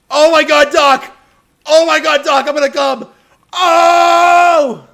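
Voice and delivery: loud, high, excited shouting; excited yelling. Acted. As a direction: yell it out in mock excitement. Excited yell at game scene.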